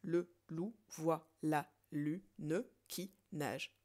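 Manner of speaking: slow, syllable by syllable; slow syllable count. Shown as a demonstration. Teacher recounting the syllables slowly for students. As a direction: spell the line out slowly. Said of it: "Je recompte".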